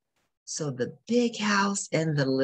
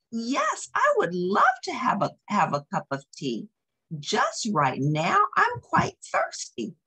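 much higher, bright squeaky acceptance; a tiny mouse thrilled to be asked; eager little mouse; 7 semitones higher than usual. Acted.

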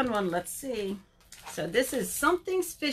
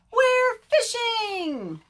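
high, loud, stretched-out chorus; group shout; 12 semitones higher, 7 dB louder, much slower than usual. Acted. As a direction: sing out the answer slowly and loudly. Everybody answers the bear.

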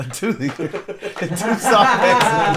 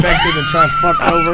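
squealed. Pretend. Shouts the phrase in a squeal.

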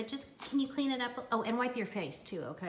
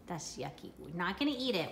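ordinary delivery

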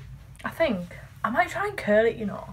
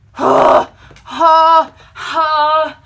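exasperated laugh; 6 semitones higher, 16 dB louder, much slower than usual. Real, not acted.

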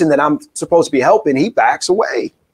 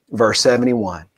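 ordinary talk

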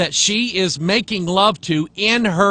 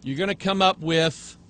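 normal talking voice

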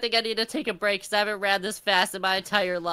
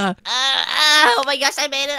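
loud, raised straining cry; straining effort; 4 semitones higher, 6 dB louder than usual. Pretend. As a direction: heave the words out as you just make it. Struggles across past fan.

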